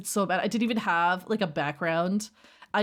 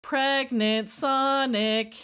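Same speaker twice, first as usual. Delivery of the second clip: slow mock read; 4 semitones higher, much slower than usual. Real, not acted. Reading a funny chat name.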